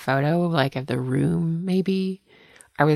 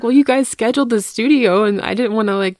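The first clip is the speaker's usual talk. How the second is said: meek explanation; 8 semitones higher, 6 dB louder than usual. Retold or imitated. Retelling her own dutiful explanation.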